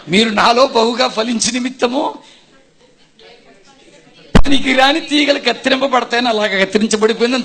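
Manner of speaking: loud scripture proclamation; proclaiming scripture. Retold or imitated. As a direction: declaim the verse with force. Scripture quoted in testimony.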